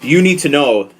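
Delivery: normal talking voice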